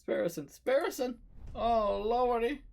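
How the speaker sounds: rising panicked cries of his name; alarmed calling out. Acted. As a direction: call out in growing alarm.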